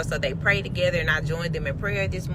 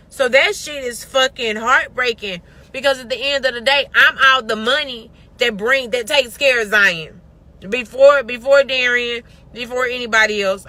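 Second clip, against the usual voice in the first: louder and louder, pushing out real frustration; frustrated outburst; 4 semitones higher, 6 dB louder than usual. Real, not acted. Venting to viewers about carrying the money alone, frustrated and loud. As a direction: hammer each "before" like a grievance.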